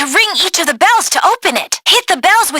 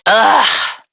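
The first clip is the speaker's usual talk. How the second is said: annoyed groan; 8 semitones lower than usual. Acted. Groan at bumpy flight.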